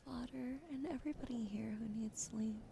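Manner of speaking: usual speaking voice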